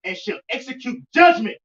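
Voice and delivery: forceful verse reading, key words drawn out; scripture reading. Real, not acted. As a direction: proclaim the verse phrase by phrase, loud on the big words. Rereads the verse aloud.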